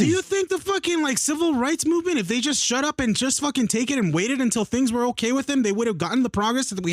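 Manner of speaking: high-pitched, indignant rhetorical question; heated challenge. Real, not acted.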